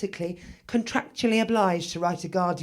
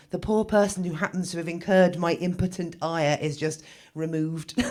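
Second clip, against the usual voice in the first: ordinary talk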